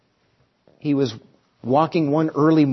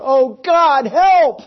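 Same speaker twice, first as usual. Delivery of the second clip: much higher, loud panicked cry; desperate cry for help; 9 semitones higher, 6 dB louder, much slower than usual. Retold or imitated. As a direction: yell for help as the bear pounces. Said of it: "the man cries out".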